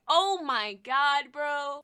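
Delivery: loud, slow, giddy "oh my god"; overexcited. Real, not acted. Reviewer losing it over his favorite breakdown, loud and giddy. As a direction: let the thrill boil over, drawn out. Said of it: "I'm getting too excited".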